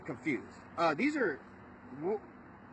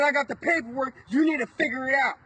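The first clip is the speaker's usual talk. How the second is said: angry retort; 4 semitones higher, 6 dB louder than usual. Retold or imitated. Arguing with the cop in jail.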